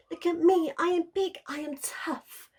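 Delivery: high, loud little pup boasting; young wolf growling. A narrator's reading. Little wolf Wilf showing off his strength, growling high. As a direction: puff up like a tiny wolf trying to sound fierce. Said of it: "he would growl".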